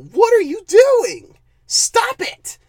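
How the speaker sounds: high, loud, fed-up yelp at the screen; exasperated outburst. Real, not acted. Reviewer yelling at the CGI filmmakers, exasperated high shout. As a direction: throw up your hands and shout at the filmmakers.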